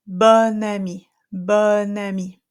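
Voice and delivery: bon ami with the N carried into ami, slow; French liaison; linked N. Shown as a demonstration. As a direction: join bon to ami with a clear N. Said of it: "it's gonna be the same".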